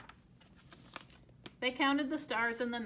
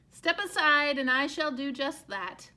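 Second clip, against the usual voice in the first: bossy push; 3 semitones higher, 9 dB louder than usual. Acted. Duck pushes past.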